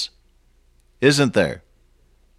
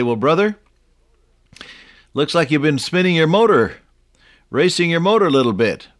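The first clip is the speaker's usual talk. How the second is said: mild teasing rebuke; 5 semitones higher than usual. Retold or imitated. Chiding a burnt-out worker.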